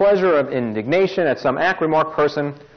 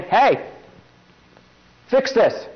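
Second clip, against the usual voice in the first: higher-pitched customer complaint; mock angry demand; 7 semitones higher, slower than usual. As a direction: demand it of the manager, short of a shout. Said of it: "I'm yelling".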